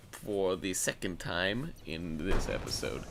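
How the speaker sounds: ordinary delivery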